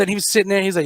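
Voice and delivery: ordinary delivery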